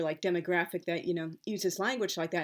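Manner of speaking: ordinary talk, nothing marked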